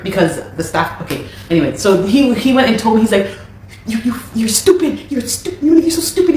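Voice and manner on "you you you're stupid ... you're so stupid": high, sneering mock imitation with a laugh; mocking his insults. Mocking her manager's insults in a high, laughing sneer. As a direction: ridicule him by parroting his insult.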